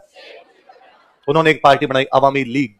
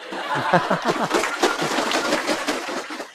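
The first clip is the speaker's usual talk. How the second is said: a quiet airy chuckle; soft breathy laugh; 2 semitones higher, 7 dB quieter than usual. Real, not acted. Teacher laughing at his own amen joke, quietly.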